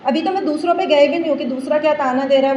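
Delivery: ordinary talk, nothing marked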